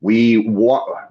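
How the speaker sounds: ordinary delivery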